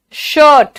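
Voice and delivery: शर्ट with a long er vowel, shərt; correct pronunciation. Shown as a demonstration. Teacher modelling shirt for students, correct drawn-out vowel. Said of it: "बेटा शर्ट नहीं".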